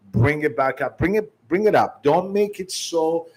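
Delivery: normal talking voice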